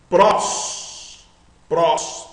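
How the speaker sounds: PRÓS as a single syllable with open o; one open syllable. Shown as a demonstration. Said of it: "uma sílaba só".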